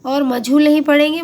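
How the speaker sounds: usual speaking voice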